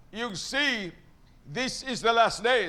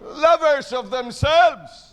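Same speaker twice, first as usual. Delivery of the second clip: loud, slow proclamation; 5 semitones higher, 7 dB louder, much slower than usual. Acted. Preacher thunders the verse at the congregation, loud and slow. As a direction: boom it out slowly.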